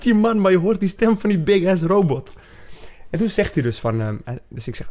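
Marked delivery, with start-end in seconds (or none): 0.0-2.3 high, loud, thrilled gushing; excited gush. Retold or imitated. Telling her friend about meeting the voice actor, high thrilled gush. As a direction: squeal it like a starstruck fan. Excited fan reaction retold.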